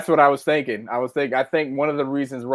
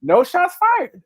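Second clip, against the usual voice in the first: high, slow mock-innocent protest; protesting denial; 5 dB louder, slower than usual. Real, not acted. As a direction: deny it with a drawn-out high voice. Playful denial of a jab.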